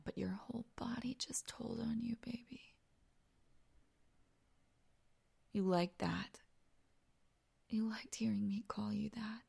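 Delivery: soft, whispery gloating; intimate teasing. Acted. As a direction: drop to a hushed, knowing taunt. Acted intimate teasing after pet name.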